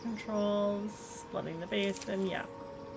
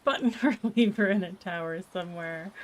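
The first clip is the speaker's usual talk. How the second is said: laughing through the words; 9 dB louder than usual. Real, not acted. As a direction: giggle while talking. Laughing at own guess.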